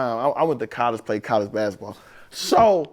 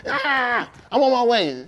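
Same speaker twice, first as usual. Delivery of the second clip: lightly higher mocking voice; imitating wife; 3 semitones higher, slower than usual. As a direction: mimic her breezy reply. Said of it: "I was talking like that?".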